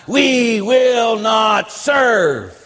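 high, slow, stretched-out yell; mock screaming nun. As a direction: imitate her screamed slogan, word by word. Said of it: "she pounded the podium and she screamed".

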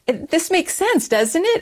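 high-pitched imitation of a teen's reasoning; retold youthful insistence; naive certainty. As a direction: appeal to obvious logic, voice lifted high. Imitating her thirteen-year-old self reasoning.

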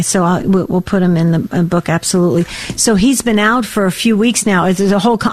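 ordinary delivery

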